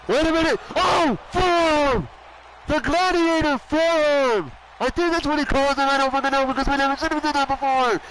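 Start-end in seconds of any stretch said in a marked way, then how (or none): 0.1-4.5 higher, punchy hype call; excited call. Real, not acted. Commentator calling the forearm finisher, excited. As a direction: yell the finisher name. Commentator calls big move.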